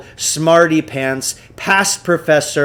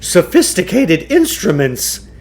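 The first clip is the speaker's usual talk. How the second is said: a bit higher, louder huckster boast; Klugarsh's voice; 6 semitones higher, 6 dB louder than usual. A narrator's reading. Shopkeeper bragging to the boys, high showman voice. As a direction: puff up like a showman selling gadgets.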